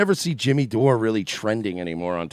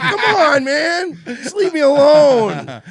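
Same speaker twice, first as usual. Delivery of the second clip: high, loud laughing plea, slow at the end; laughing exasperated whine; 9 dB louder, much slower than usual. Retold or imitated. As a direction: beg to be left alone through laughter. [name] pleading, laughing.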